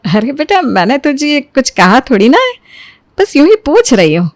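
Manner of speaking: ordinary delivery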